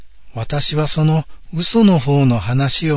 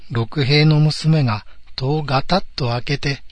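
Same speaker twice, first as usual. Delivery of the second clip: ordinary delivery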